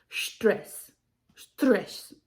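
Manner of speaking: German accent, Stress with a sh start. Shown as a demonstration. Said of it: "thick German accent".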